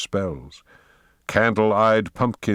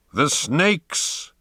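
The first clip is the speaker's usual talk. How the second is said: high scream of command; 7 semitones higher than usual. Acted. Poe screams the order to attack the rocket. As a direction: scream the order across the sand. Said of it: "screamed Poe".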